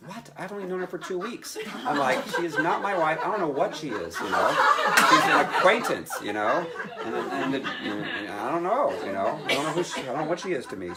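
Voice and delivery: high, loud and laughing; flustered laughing protest. Retold or imitated. Denies she is his wife.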